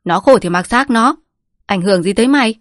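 to hơn, gắt gỏng với con; mắng con cho im; quát tiếp. A narrator's reading. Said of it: "Quất quát". (người bố quát con gái)

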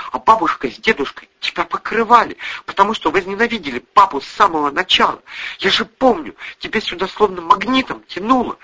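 high-pitched, heated accusation; accusing. A narrator's reading. Daughter accuses mother of betraying father, heated.

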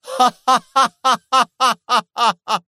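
long, high villain cackle; dreadful triumphant laugh. A narrator's reading. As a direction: let out a long, terrible laugh of victory. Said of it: "ضحكة ظافرة رهيبة".

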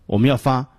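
ordinary talk

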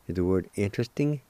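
ordinary talk, nothing marked

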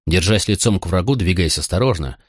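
plain reading voice

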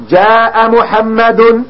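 جاء محمد said very slowly, the final damma on محمد sounded out; slow textbook demo of جاء محمد with audible damma; clearly voiced ending. Shown as a demonstration. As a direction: stretch جاء محمد and let the u-ending on محمد ring. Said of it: "تلفظنا بالعلامة أم لا؟".

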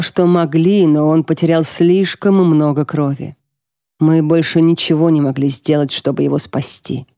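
plain reading voice

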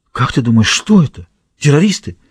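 mildly scared, pitch jumping up at the end; a touch of panic in an anxious question; breaking, frightened voice. A narrator's reading. Sweating guard asks if it's terrorists, frightened voice. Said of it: "Срывающимся голосом, весь в потУ от страха".

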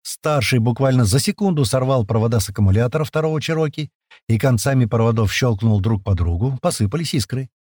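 ordinary delivery

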